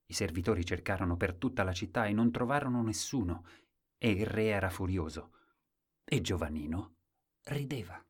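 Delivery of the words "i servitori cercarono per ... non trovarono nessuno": ordinary delivery, nothing marked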